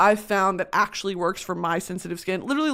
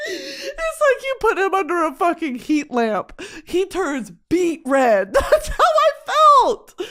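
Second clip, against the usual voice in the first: much higher, laughing through the words; laughing squeal; 9 semitones higher, 4 dB louder, slower than usual. Real, not acted. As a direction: squeal it out in giggles. Laughing at the memory.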